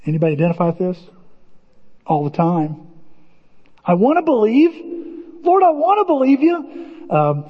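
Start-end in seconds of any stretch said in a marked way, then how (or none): 3.8-6.9 pleading prayer. Acted.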